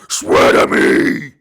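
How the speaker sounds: loud, slow rasping roar. Retold or imitated. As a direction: roar it like Bale's Batman.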